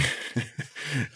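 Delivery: a quick soft laugh, mostly breath; short laugh. Real, not acted. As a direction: let a little laugh slip out. Guest laughs at own remark.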